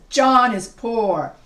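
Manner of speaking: JOHN and POOR loud and drawn out; emphasized clause. Shown as a demonstration. Teacher showing writers which clause to emphasize, JOHN IS POOR stressed. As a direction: punch "John is poor" slowly and loudly. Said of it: "You want to emphasize".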